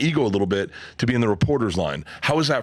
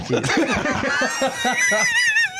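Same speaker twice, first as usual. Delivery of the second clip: big laugh. Real, not acted. Big laugh after his story.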